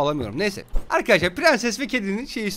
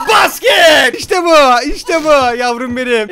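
loud celebratory shout, higher at first; triumphant cheer; 4 semitones higher, 8 dB louder, slower than usual. Real, not acted. Player celebrating scoring with the baby, triumphant shouting. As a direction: cheer the shot, stretching the end.

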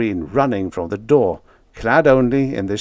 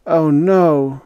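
weak; 7 semitones higher, 6 dB louder than usual. A narrator's reading. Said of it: "he said weakly".

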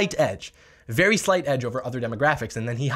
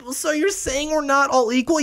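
put-on much higher whiny childish voice; whiny question; 11 semitones higher than usual. Mocking opponents to viewers, asking in a high childish whine. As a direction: plead like an offended kid. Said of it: "It's like".

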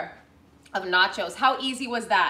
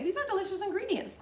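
normal talking voice

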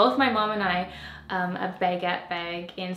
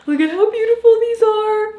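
much higher and louder, thrilled; excited gushing; 14 semitones higher, 9 dB louder than usual. Real, not acted. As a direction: squeal over the new shoes. Showing new shoes, gushing.

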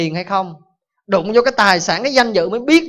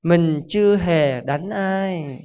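very slow calm monk; much slower than usual. Acted. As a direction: answer serenely and drag every word. Calm monk replying to the father.

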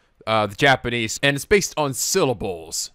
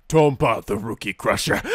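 lightly lowered menacing intro; deeper voice; 5 semitones lower than usual. Retold or imitated. Viewer imitating the deep-voiced rookie crusher. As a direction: drop the voice for the villain. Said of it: "it's just suddenly gets a lot deeper".

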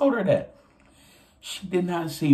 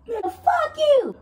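much higher, slow, hammed-up yell; mock outburst; 4 dB louder, slower than usual. Teen yelling at the creepy driver, hammed-up yell. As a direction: throw it back at the driver as an over-the-top outburst. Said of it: "I'm like".

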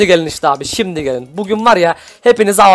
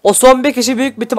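ordinary talk, nothing marked

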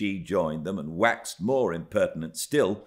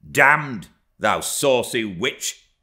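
loud, higher, defiant curse; angry retort; 5 semitones higher, 7 dB louder, slower than usual. A narrator's reading. Hareton cursing the saucy girl, loud angry retort. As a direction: throw the insult back loudly. Lad swears back at Cathy.

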